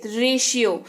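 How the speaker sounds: रेशियो said with a clear "sh" for the t; रेशियो, t sounding as श; रेशियो pronounced re-shi-yo; ratio with sh. Shown as a demonstration. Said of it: "यहाँ पे तालव्य श जो है वो टी का उच्चारण".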